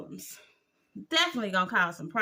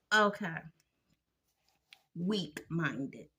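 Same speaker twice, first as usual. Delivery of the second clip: ordinary delivery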